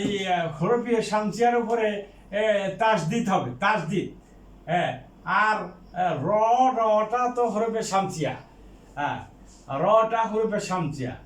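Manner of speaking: normal talking voice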